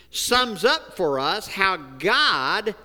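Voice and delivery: ordinary talk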